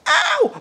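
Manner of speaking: sharp high yelp of pain. Real, not acted. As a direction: cry out as you bang your knee. Banged knee on desk.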